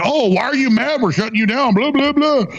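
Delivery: high-pitched mocking complainer; whiny mockery tailing into blah-blah; mock bitching. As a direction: imitate people griping, pitched well up. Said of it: "People, like, bitching".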